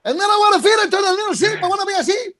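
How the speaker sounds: high-pitched mock foreign accent; hammed-up accent, pushed far up in pitch; Hector Lombard accent. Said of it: "Hector Lombard accent".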